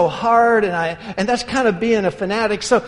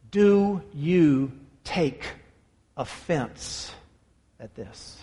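very slow, dropping softer on each word; slow, hushed emphasis; 5 semitones lower, 6 dB quieter, much slower than usual. Acted. Preacher voices Jesus' question to his disciples, slow and hushed. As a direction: let each word sink in, fading to a hush. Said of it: "The reason I emphasize that".

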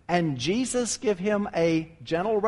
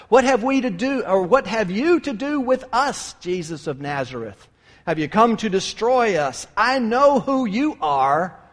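demons; 5 semitones higher, 5 dB louder than usual. Retold or imitated. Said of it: "what those demons said".

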